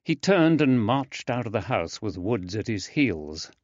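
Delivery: usual speaking voice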